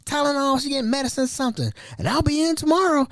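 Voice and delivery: high-pitched mock sick caller; playing it cool. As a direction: imitate her casual excuse, high and halting. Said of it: "she's playing like it's cool".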